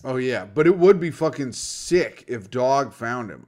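normal talking voice

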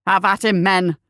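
loud commanding battle cry; roar. A narrator's reading. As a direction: roar the order to your troops. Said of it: "he roared".

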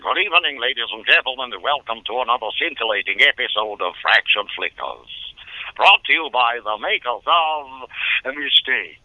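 high, bright announcer intro; old radio announcer. Shown as a demonstration. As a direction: deliver it like a show announcer. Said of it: "an introduction to the Jay Ward series, Fractured Flickers".

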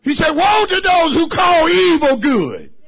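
high, loud shouting testimony; fiery sermon shout; preaching at full force. Real, not acted. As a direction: whoop it up for the congregation.

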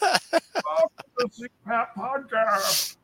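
slow muffled voice through a mask; lightly done masked villain voice; Bane voice. Pretend. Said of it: "So it actually does sound like Bane".

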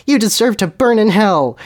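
high mock shriek; hammed-up high-pitched condemnation; knowing shriek. Harry condemns Voldemort to hell, in a mild shriek. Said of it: "Harry shrieked knowingly".

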